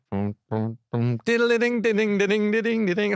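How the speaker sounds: mock Larry David scatting the rhythm up high; Larry David impression. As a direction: riff the tune like a hint of Larry David. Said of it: "a full Larry David".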